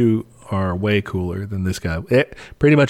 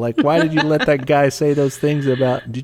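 mock complaint call, pitched up; Joe Biden; 5 semitones higher than usual. President phoning to complain about a report, mock scolding. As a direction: pretend to scold someone over the phone. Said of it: "what is Joe Biden gonna do? Call us up".

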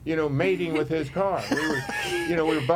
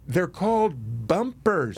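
drawn-out retort; much slower than usual. Retold or imitated. Telling off the other driver.

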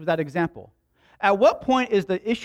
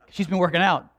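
normal talking voice

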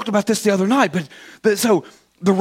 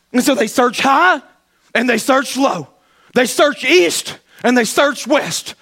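rising, louder preaching build; building sermon; 8 semitones higher, 3 dB louder than usual. Acted. Preacher rousing the church toward climax. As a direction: climb in pitch as the search fails.

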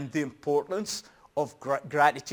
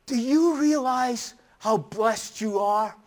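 stern scolding parent, higher and insistent; drilling it in; 6 semitones higher, 3 dB louder than usual. Retold or imitated. Father overdoing a gratitude lesson to his son, drilling it in. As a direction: imitate lecturing a child, pressing every point. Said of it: "I drilled it in".

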